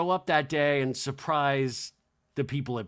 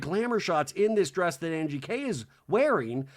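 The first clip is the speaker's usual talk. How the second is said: ordinary delivery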